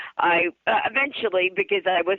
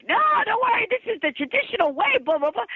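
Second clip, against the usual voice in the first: higher-pitched mock reassurance; breezy dismissal; 12 semitones higher than usual. Salon staff reassuring a worried client, breezy mock dismissal. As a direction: lightly imitate them brushing off the worry.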